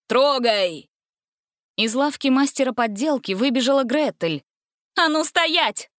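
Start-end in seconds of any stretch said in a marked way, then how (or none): 0.1-0.9 mustache twirling villain. A narrator's reading. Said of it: "Али Баба зловеще рассмеялся и скомандовал". 5.0-5.9 high shouted command to halt; shouting. A narrator's reading. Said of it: "закричала она".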